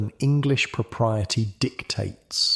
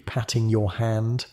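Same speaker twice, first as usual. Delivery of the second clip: plain reading voice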